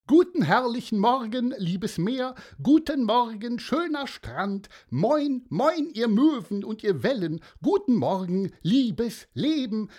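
hoch und laut ausgerufene Morgengrüße; fröhlicher Mann. Acted. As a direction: das Meer überschwänglich laut begrüßen. (Mann im Schlafanzug grüßt das Meer, hoch und laut)

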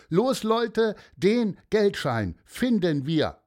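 eager rallying call. Acted. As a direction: fire up the search team. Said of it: "rief Malte".

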